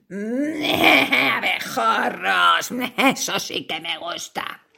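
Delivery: low, savoring spider voice; hungry spider. Acted. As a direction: purr over a tasty meal. Spider relishes the idea.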